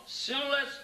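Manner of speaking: ordinary talk, nothing marked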